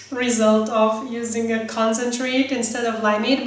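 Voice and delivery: normal talking voice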